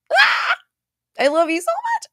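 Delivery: high squeal then gushing thanks; overwhelmed with joy, voice jumping up; emotional squeal. Real, not acted. Reading a fan's message, squealing with emotion. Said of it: "I'm literally tearing up".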